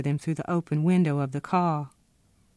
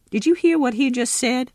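high, loud, indignant splutter; an outraged old man, high and loud, after the soldier leaves; sputtering outrage; 8 semitones higher, 5 dB louder than usual. A narrator's reading. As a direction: spit out the words in disbelief. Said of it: "sputtered Pappy".

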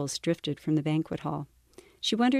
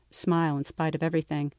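usual speaking voice

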